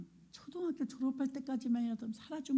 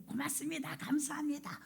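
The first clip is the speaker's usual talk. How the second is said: put-on child's thanks; 7 semitones higher, 6 dB quieter than usual. Child thanking, voiced by the preacher.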